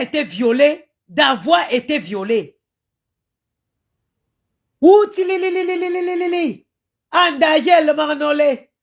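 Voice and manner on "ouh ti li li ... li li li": high trilling li li li cry; ululation. Real, not acted. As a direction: ululate like a mourning wail. Ululating again in lament.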